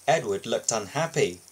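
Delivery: usual speaking voice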